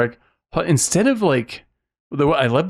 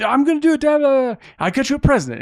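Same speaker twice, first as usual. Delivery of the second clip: much higher, wired and eager; hyped-up son; faster than usual. Retold or imitated. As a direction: bounce it like an amped-up kid trying to impress Dad. Son eagerly answers dad.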